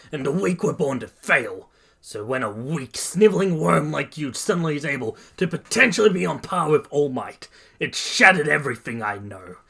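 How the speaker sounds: resentful tirade building to a shout; scoffing rant. A narrator's reading. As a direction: let bitterness build into a loud demand. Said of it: "Bakugo scoffs and says".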